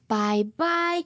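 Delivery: plain reading voice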